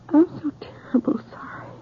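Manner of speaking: whispered, barely voiced apology; tearful whisper. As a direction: breathe the words out in grief. Grieving widow apologizing to mother.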